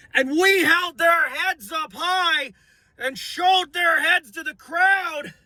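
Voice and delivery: high, slow, straining shout; hoarse shout. Real, not acted. Fan gloating about beating the Giants, high slow shout. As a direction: yell it to the whole crowd.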